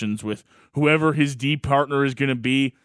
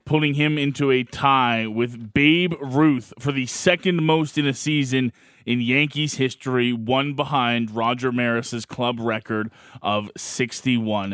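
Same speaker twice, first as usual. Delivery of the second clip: dramatic sports recap; 3 semitones higher, slower than usual. Acted. Dramatic recap after the clip.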